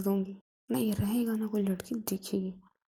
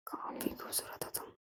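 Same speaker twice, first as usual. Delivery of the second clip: tender whisper; 12 dB quieter than usual. Acted. Lover whispering compliment.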